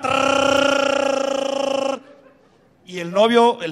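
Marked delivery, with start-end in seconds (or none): 0.0-2.0 long high rolled-tongue gunfire trill; high sustained trilled rat-a-tat; imitated machine-gun fire. Ezequiel starts firing his rifle, long high trilled gunfire noise. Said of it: "empieza a disparar".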